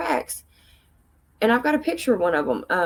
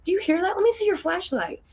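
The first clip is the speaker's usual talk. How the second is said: high urgent demand; pitched up, pressing for the light; urgent request; 10 semitones higher than usual. Retold or imitated. Demanding the friend's flashlight in the dark, urgent. Noise in the woods again.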